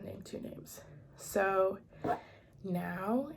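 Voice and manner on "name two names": soft whisper, trailing off; whispered aside. Real, not acted. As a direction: drop to a whisper. Repeats phrase as a whispered aside.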